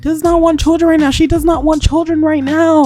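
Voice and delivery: high, loud, insistent; emphatic insistence. Real, not acted. Player overruling the game's hopes prompt, high and insistent. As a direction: raise the pitch and hammer each refusal. Exclaims over the character's unwanted child.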